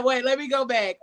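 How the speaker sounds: ordinary talk, nothing marked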